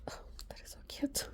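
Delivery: soft breathy whisper; tender hushed whisper. Real, not acted. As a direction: whisper it to yourself.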